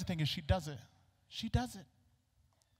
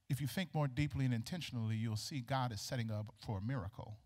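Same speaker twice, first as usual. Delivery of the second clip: usual speaking voice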